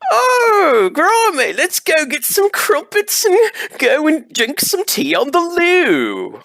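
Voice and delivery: very high, loud, hammed-up posh British accent; mock British accent. Shown as a demonstration. Interviewer showing off his British accent to the guests, hammed-up posh British. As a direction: squeal it out in an over-the-top British accent. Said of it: "criticize my British accent that I'm gonna do".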